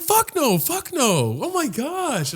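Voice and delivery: high-pitched mock protest; teasing squeal of rejection; flirty refusal.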